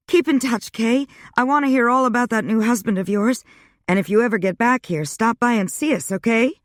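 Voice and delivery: a cheerful friend talking quickly on the phone; high, quick girlfriend voice; Wanda voiced high. A narrator's reading.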